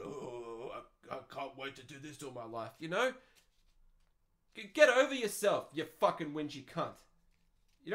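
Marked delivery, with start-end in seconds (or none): 0.0-2.7 quiet, lightly voiced caveman, mock delight; soft, dreamy mock enthusiasm; mock eager caveman. Cavemen rubbing sticks, quietly voiced with mock eagerness. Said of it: "they're going".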